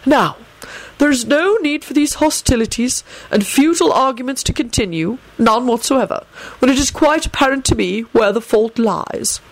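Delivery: a hint of a lofty headmaster; headmaster's composure. A narrator's reading. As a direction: preside over the quarrel in measured formal tones. Headmaster calming a quarrel.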